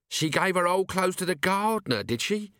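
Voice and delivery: Nobby's voice. A narrator's reading. Nobby asks, voiced in the story.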